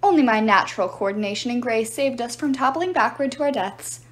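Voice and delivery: usual speaking voice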